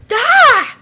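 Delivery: short, loud high scream. Acted. Girl startled by the fellow over there, screaming. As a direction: shriek out in shock. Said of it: "sorry for screaming there".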